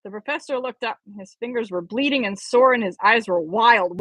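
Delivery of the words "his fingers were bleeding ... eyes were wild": ordinary delivery, nothing marked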